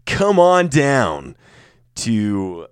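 high, loud, slow promo call; drawn-out "come on down" announcer shout; mock announcer. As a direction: beckon the crowd like an advertiser. Mock advert for beach volleyball.

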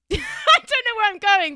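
high, loud, laughing confession; laughing admission. Real, not acted. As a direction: crack up as you lose the thread. Loses her thread, laughing.

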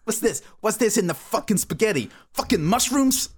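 put-on high-pitched New York mobster accent; outraged Italian-American wiseguy accent; Sopranos accent. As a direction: complain like a hammed-up Sopranos gangster. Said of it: "launched into a Sopranos accent".

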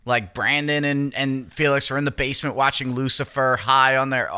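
ordinary delivery

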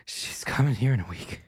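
winded, voice giving out into a whisper; out of breath. Acted. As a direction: wheeze it out between long breaths. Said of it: "Oh, God, I'm out of shape".

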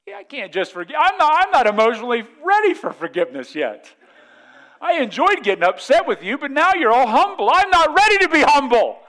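high, loud, hammed-up indignation; mock exasperation. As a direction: build into a comic outburst of refusing forgiveness. Acted spouse refusing to forgive.

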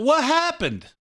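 high, squeaky what happened; comic catchphrase. Retold or imitated. Host imitating a TV comedian's catchphrase, high and squeaky. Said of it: "Somebody should Google that".

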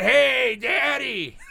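high, loud call to Daddy; mock kid calling. Acting out a kid hitting up Dad, high mock shout.

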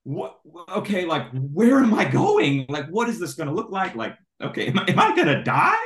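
high, loud, slowing on the questions, hammed-up fear; mock panic. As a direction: play up the panic for the crowd. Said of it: "I was freaking out".

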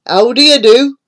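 a hint of a jolly toad; lightly voiced friendly toad. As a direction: greet the child with a grin. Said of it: "He smiled and said".

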